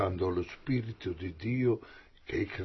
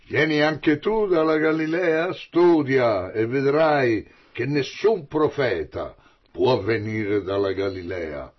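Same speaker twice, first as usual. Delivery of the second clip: replica sprezzante; 3 semitones higher, 8 dB louder than usual. Acted. Farisei zittiscono Nicodemo.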